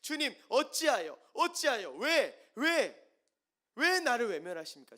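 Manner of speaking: high, loud, desperate repeated why; anguished cry. Acted. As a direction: wail at God for abandoning you. Psalmist crying out to God. (높고 크게 절규하는 하소연)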